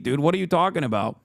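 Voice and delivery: normal talking voice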